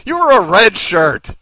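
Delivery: ordinary delivery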